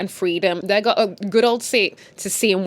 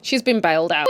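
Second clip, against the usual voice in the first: normal talking voice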